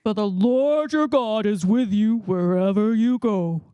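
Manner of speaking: low, booming voice; deep voice from the chest. Shown as a demonstration. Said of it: "let's try a deep voice".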